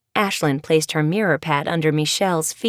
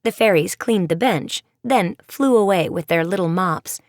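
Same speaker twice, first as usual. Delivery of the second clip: usual speaking voice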